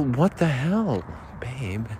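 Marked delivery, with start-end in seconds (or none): 1.4-1.9 soft whispered babe. Acted. Whispered aside.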